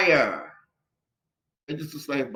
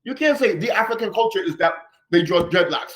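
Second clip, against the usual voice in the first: usual speaking voice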